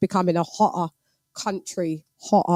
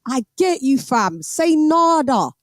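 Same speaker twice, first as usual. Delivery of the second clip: higher, punchy London roadman voice; London street slang accent; 5 semitones higher than usual. Shown as a demonstration. Londoner demonstrating Top Boy slang, London street accent. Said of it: "So they talk like".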